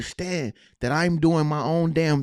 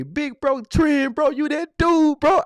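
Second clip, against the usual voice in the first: hyped-up fan voice, higher and louder; flattering fan; 12 semitones higher, 6 dB louder, slower than usual. Retold or imitated. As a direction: gush like an adoring commenter. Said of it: "buy a comment to say".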